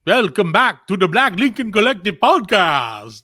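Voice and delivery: spooky, slow vampire host voice; drawn-out creepy vampire welcome. Acted. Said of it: "You have to work on your vampire voice".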